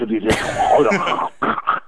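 slow, drawn-out mock French accent. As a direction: stretch it out in a hammy French accent. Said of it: "It's just the way they speak".

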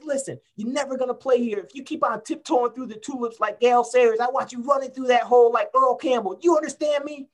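high, strained and loud coach's rant; mock tirade of an old football coach; imitating coach yelling. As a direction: chew out a player on the field. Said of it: "He says".

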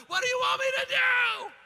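loud, very high mock-frantic shout; retold yelling. Preacher reenacting a household yelling match, high frantic shout. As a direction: yell back in exaggerated panic. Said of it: "we're yelling at each other".

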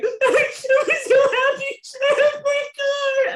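very high, drawn-out wailing squeal; hammed-up happy crying. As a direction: re-enact a sobbing, overjoyed video confession, loud and slow. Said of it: "exactly like that".

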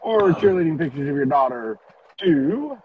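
loud high game show host over music; announcer voice. Pretend. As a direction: keep the ringmaster hype going. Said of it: "show us the right way to".

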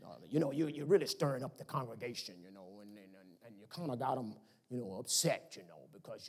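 mumbling, awkward church elder; deacon board scolding. Retold or imitated. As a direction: deacon board scolding the pastor, low and hedging. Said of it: "The deacon board".

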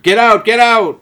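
high, loud shout to clear the theater; mock yell. Host imagining staff yelling at fans, loud shout. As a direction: yell everyone out of the building.

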